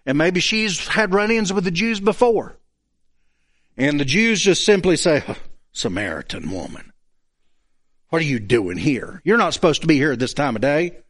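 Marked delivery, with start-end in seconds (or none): 5.2-11.0 low, cold dismissal; no compassion. Acted. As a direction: brush her off without any empathy. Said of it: "No compassion, no empathy, no nothing".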